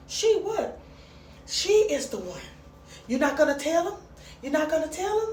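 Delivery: high, animated African-accent imitation; badly attempted African accent, incredulous. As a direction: act out disbelief in a put-on African accent. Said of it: "I can't do the African voice".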